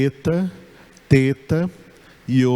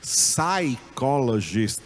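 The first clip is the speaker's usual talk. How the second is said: Psychologist slowly in English, sai-ko-lo-jist, no P; English pronunciation; much slower than usual. Shown as a demonstration. Teacher modelling the English word for psicólogo, slow. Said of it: "o psicólogo é psychologist".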